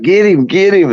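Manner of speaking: calling the boat crew to grab the gator; hammed-up excited call; mock call. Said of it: "talking about".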